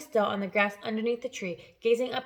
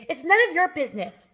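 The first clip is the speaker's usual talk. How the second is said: angry snap; 6 semitones higher, 4 dB louder than usual. A narrator's reading.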